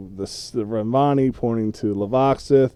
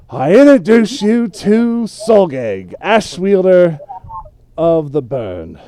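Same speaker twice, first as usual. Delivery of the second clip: loud, high, booming introduction of a warlord; big ceremonial shout, easing on the last words; grand announcement; 8 semitones higher, 10 dB louder than usual. Acted. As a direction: proclaim the name and title like a herald. Game master voices grand formal introduction.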